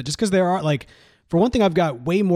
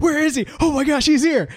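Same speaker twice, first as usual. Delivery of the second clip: mock panic; 11 semitones higher, 3 dB louder than usual. Retold movie scene, panicked agents.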